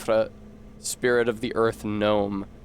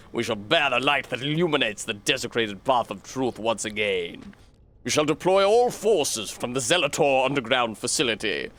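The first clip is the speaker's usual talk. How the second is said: zealous cult leader addressing his followers, solemn and grand; cult leader sermon. Acted. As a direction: preach it like a fanatic prophet sending troops off. Voices game cult leader's speech.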